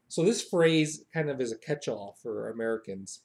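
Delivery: ordinary delivery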